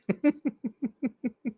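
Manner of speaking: very high, rapid giggle; giddy laugh. Real, not acted. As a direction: giggle giddily with goosebumps. Giddy with goosebumps.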